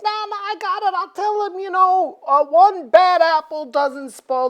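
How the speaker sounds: high, goofy cocky voice; goofy Iceman voice. Retold or imitated. Iceman reassures the reporters, high and goofy. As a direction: play a cocky dude brushing it off. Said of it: "But Iceman's like".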